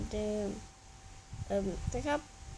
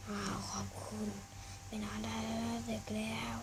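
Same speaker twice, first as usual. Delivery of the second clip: whispered dad; 8 dB quieter than usual. Acted. Dad thanks son, sends to bed.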